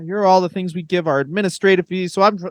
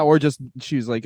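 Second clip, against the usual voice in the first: ordinary delivery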